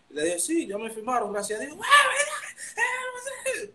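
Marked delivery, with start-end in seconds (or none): 0.4-1.8 high, laughing triumphant reply; pitched-up joyful announcement with a laugh; excited news. Retold or imitated. Telling sister the news. 1.8-3.7 very high, slow, laughing shriek; a sister screaming in tearful delight. Retold or imitated.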